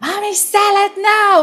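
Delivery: put-on high, loud childish yell; imitating a screaming toddler. Daughter screams at mother for salad, high loud yell. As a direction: yell it sky-high like a tantrum. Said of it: "And she's screaming".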